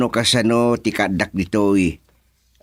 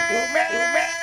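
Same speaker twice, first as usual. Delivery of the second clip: crying call. Acted. Calls fade, crying.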